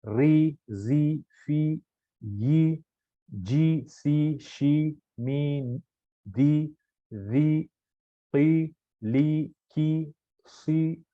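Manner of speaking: ई बी ती सी, each with a long ee; slow syllable-by-syllable recitation; zer drill. Shown as a demonstration. Teacher drills the zer letters for students, slow. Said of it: "आवाज नीचे की तरफ जाएगी".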